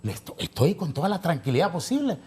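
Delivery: high, insistent denial in an argument. Real, not acted. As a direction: protest hotly, pitch jumping up, without a full shout. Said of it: "Pero no es gritando".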